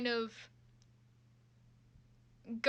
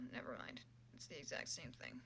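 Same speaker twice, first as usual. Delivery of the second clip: unvoiced, much softer mutter; whispered mutter; 10 semitones lower, 16 dB quieter than usual. Real, not acted. Streamer muttering at the same results. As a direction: mutter it to yourself.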